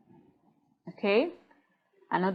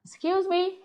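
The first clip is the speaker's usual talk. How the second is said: higher-pitched, courteous "excuse me"; polite interjection; 10 semitones higher than usual. Pretend. Student politely correcting somebody's pronunciation, courteous. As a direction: politely cut in, pitch lifted. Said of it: "tell them politely".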